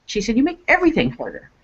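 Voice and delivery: a hint of a scolding sister from beyond; sister's spirit voice. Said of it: "she says".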